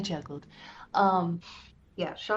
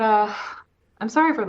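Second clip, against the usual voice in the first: normal talking voice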